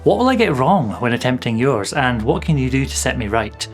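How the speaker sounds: usual speaking voice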